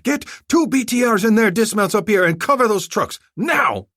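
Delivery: screamed order. Acted. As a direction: scream at the troops. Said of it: "He whirled around and screamed".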